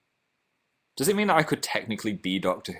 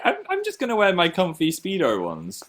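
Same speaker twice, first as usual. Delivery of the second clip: ordinary talk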